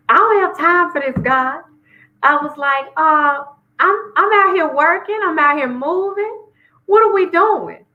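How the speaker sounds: high, exasperated venting; exasperated complaint to God. Retold or imitated. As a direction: toss it up at God like you're fed up.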